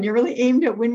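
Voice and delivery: ordinary talk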